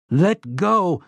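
high, pained cry. A narrator's reading. Slightman cries out as the robot grips him, pained. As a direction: cry out as if gripped painfully. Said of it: "Slightman cried out".

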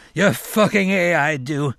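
high, tearful affirmation; weeping assent. A narrator's reading. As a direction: cry out agreement through tears. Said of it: "He began to weep".

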